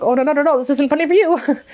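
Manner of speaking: high, loud mock protest; urgent protest. Imitating herself telling her boyfriend it isn't funny, urgent protest. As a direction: blurt it out, pitched right up.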